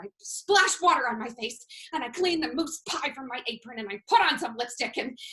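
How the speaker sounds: ordinary talk, nothing marked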